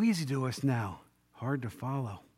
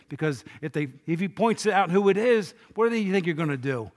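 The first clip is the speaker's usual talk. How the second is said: ordinary delivery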